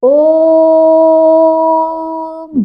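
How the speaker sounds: ओम held long and steady on one note; drawn-out vowel. Shown as a demonstration. Teacher stretching ओम to show a pluta vowel. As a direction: hold ओम out like a chant. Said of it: "जिसको हम जितना लंबा खींच सकें".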